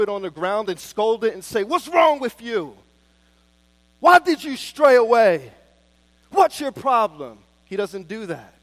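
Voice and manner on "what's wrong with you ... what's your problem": loud, higher, exasperated scolding of the sheep; mock scolding. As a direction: bark at the stray like a harsh master. Acted scolding shepherd, contrasted.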